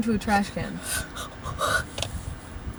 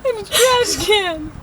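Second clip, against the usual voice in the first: high, slow words breaking into laughter; laughing through words; 8 semitones higher, 3 dB louder, slower than usual. Real, not acted. Friend mocking the kid in the trash, laughing. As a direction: squeeze the phrase out while cracking up. Cracking up while quoting.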